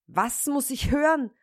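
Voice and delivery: höher und lauter, empört aufschreckend; ungläubig aufgebracht rufen; empörter Ausruf. Acted. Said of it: "rief der Schneider". (Schneider hört die Ziege, empört ausrufend)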